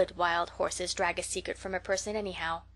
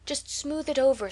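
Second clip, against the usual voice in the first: eager; 6 semitones higher than usual. A narrator's reading. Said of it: "said Matthew eagerly".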